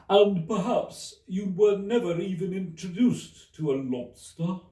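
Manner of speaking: high, loud, mournful Mock Turtle. A narrator's reading. The Mock Turtle quizzes Alice, high and mournful. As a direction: stretch it out like a weepy old turtle.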